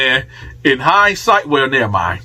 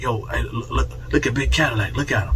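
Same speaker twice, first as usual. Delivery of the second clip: urgent hush; 4 semitones lower, 7 dB quieter, faster than usual. Retold or imitated. Alerting Al to armed Cadillac.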